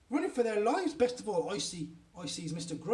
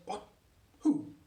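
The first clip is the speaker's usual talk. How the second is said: voice gone funny; 2 semitones higher than usual. A narrator's reading. Father taking a shocking phone call, voice gone funny. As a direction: blurt it out in a funny startled voice. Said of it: "his voice goes all funny".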